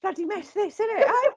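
squeaky, exaggerated London-lad accent; Oscar Isaac's British accent. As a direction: ham up a bad British accent, way up high. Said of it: "his idea of doing a British accent".

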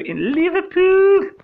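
high, slow, hammed-up Liverpool accent; a loud mock Scouser; over-the-top Liverpool accent; mock Scouse accent. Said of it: "just murdered your accent".

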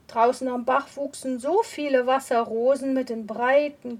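usual speaking voice

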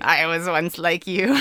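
put-on slow, laughing old-woman voice; old crone voice. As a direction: drawl it like a wise old crone. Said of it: "like, an old crone voice".

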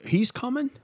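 high, slow mock surprise. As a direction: act delighted someone's coming. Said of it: "He'd be like".

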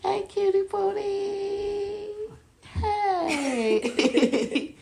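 very high, slow cooing; baby talk. Acted. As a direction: coo slowly with a laugh at the end.

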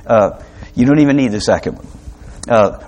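usual speaking voice

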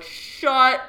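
loud, high, two words split by a pause; huge retold gasp-of-news reaction; astonished exclamation. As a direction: belt it out in disbelief, each word on its own.

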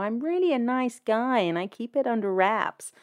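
mock a nice-guy line in a muddled accent; [name] in an unplaceable accent. Prankster claiming he's a nice guy, unplaceable put-on accent. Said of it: "I don't know what that accent was".

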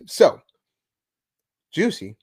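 usual speaking voice

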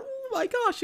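high-pitched gushing "oh my gosh"; mock excitement. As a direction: imitate giddy fans, pitch leaping up. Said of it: "excited to".

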